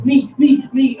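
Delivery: high, loud volunteering cries; imitated eager clamour. Students clamouring to go next.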